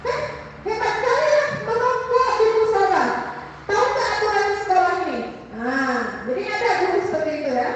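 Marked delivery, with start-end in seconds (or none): 0.1-5.2 sarcastic scolding, much higher and fast at the end; mock angry teacher. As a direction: play a teacher sneering at a kid who smoked in the prayer room. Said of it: "pasti ada sebagian guru yang responnya".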